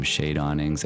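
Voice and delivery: usual speaking voice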